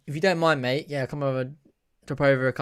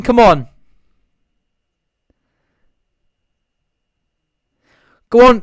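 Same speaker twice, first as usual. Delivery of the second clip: loud, raised excited calls; excited urging; 7 semitones higher, 12 dB louder than usual. Real, not acted. Fan following Willock's ball, excited shouting. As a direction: shout them on as the ball goes in. Urging team on during attack.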